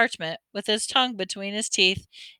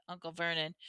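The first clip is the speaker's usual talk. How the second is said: plain reading voice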